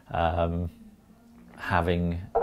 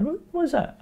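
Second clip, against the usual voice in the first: wife's puzzled question. Retold or imitated. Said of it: "She goes".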